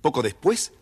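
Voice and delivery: ordinary delivery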